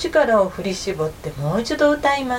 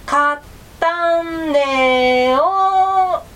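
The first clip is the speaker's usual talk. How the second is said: loud, very slow, stretched mumble through closed jaws; mumbled louder; 6 semitones higher, 4 dB louder, much slower than usual. A narrator's reading. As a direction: force the words out with the mouth still shut. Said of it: "もっとはっきり言ってよ". (口を閉じたまま大きく、引き伸ばして言う狐)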